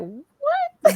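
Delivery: high, stunned mock what; baffled what. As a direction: sound utterly baffled. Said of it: "we all looked at him like".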